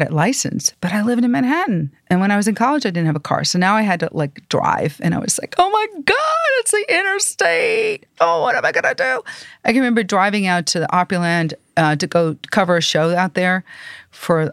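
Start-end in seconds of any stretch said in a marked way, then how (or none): 5.6-9.2 high, panicky imitation of herself on the interstate; mock panic. New driver facing the interstate, mock panic. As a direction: squeal it like a scared new driver. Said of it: "I really was afraid to drive".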